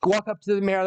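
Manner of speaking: normal talking voice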